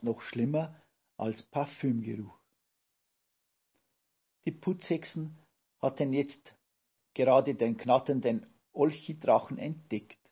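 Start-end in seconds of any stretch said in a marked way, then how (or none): none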